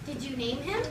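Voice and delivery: normal talking voice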